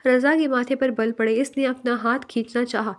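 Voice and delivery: ordinary delivery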